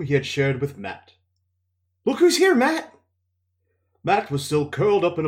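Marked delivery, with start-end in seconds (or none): 2.0-2.9 high, loud eager call. Acted. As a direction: call across the room to him. Rand calls out to Matt.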